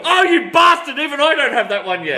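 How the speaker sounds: high-pitched, jealous acted outburst; playful, exaggerated "you lucky thing" protest; mock envious outrage. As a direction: imitate a fan indignant with envy. Customer's envious reaction, retold.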